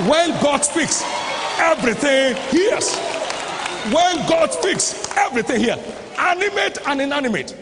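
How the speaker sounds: high, loud pulpit proclamation; full-force preaching. Acted. Preacher declaring that everything hears when God speaks, full-force preaching. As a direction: proclaim it to the whole congregation, punching each phrase.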